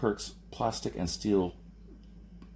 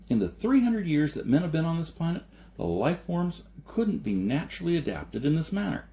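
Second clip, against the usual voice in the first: put-on higher, intense young man's voice building in pitch; earnest debater growing impassioned; Jason, rising to heated pleading; 8 semitones higher than usual. A narrator's reading. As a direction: press the argument harder and harder.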